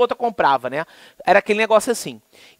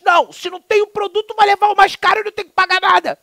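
much higher, mocking politician voice; mocking a congressman; 14 semitones higher, 5 dB louder than usual. Lecturer mocking a deputy's wrong reading of the law, high mock voice. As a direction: parody a careless deputy spouting nonsense. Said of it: "aquele deputado falando".

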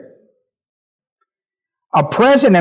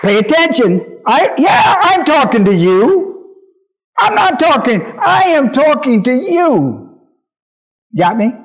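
raised, ringing call for attention; voice of command; 5 semitones higher than usual. Pretend. Preacher voicing the active voice to the congregation, raised and insistent. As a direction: demand the listener's attention, pitched high. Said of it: "The active voice says".

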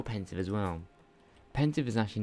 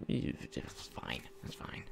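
resigned whisper; 7 semitones lower, 9 dB quieter, much slower than usual. Real, not acted. Muttered resignation.

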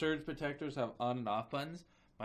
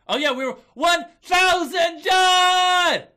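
high, slow screaming celebration; real milestone joy; triumphant shout; 15 dB louder, slower than usual. Real, not acted. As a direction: scream it out like a victory.

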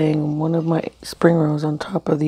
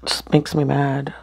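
ordinary talk, nothing marked

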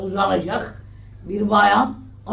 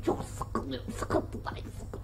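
whispering; 4 semitones higher, 15 dB quieter, much slower than usual. Acted. Sighing his woes.

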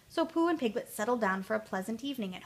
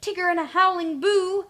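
high, loud frightened cry; squeaky panic from under a table; terrified; 8 semitones higher, 13 dB louder than usual. A narrator's reading. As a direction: repeat it in horror. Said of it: "began shaking with fear".

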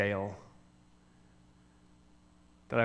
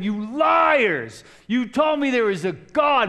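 higher, louder furious cry of betrayal; angry shouting; 9 semitones higher, 7 dB louder, slower than usual. Retold or imitated. Accusing his family of lying, a furious higher shout. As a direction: yell it at those who lied to you. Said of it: "I started shouting it".

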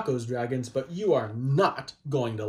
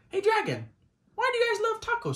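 much higher, bright call to the dragon; high-pitched hello to the dragon puppet; faster than usual. Pretend. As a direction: sing out to the little dragon.

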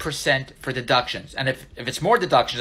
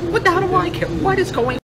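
exasperated outburst. Real, not acted. Exasperated at friend's dessert veto.

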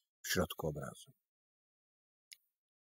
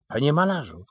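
higher, louder urgent shout; 6 semitones higher, 4 dB louder, faster than usual. A narrator's reading. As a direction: yell to snap the painter out of it. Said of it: "krzyczał pan Michaś".

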